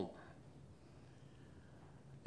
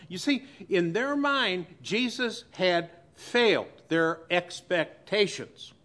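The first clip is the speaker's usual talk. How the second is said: ordinary delivery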